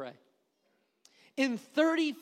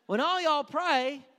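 higher and louder, all y'all hammered; building preaching; 5 semitones higher, 6 dB louder than usual. Real, not acted. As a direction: drive the repeat home. Preacher builds repeated phrase.

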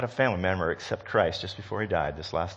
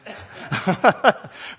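laugh; 12 semitones higher, 6 dB louder than usual. Real, not acted. Laugh amid pastor quote.

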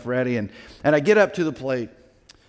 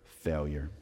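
quiet; 12 semitones lower, 12 dB quieter than usual. Real, not acted. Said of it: "confession number three".

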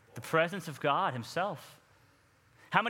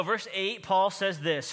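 usual speaking voice

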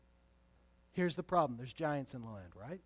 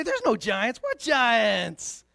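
mock denial; 5 semitones higher, 10 dB louder than usual. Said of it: "No, they didn't do that".

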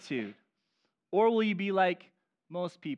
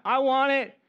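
louder, higher, demanding "I want it now"; entitled; 6 semitones higher, 7 dB louder than usual. Shown as a demonstration. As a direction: shout it like a spoiled, impatient kid. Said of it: "You sound really entitled".

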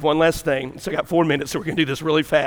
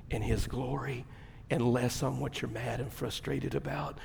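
soft, lower, tender testimony; hushed sincere; 4 semitones lower, 10 dB quieter than usual. Acted. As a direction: drop it down and let it sink in. Retelling the near-fatal crash.

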